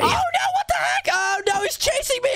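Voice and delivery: high, shouted panic; panicked yell. Acted. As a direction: cry out as he chases you. Villain attacks, panicked cry.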